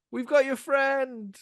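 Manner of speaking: high, slow call across water; well-meaning mock shout. Rescuers calling to the college kids as really meant, high mock shout. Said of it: "it sounds like this".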